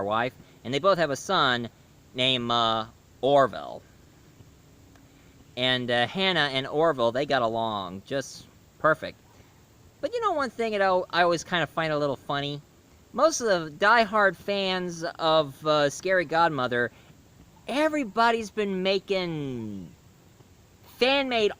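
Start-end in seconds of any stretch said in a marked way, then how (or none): none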